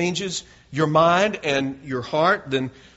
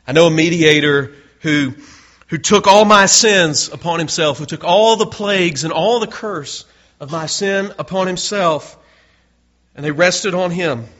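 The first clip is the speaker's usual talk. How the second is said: rising, louder preaching crescendo; preaching climax; 3 semitones higher, 6 dB louder than usual. Acted. Preacher proclaiming Christ the mediator to congregation, impassioned and loud. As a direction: build the proclamation with fervour.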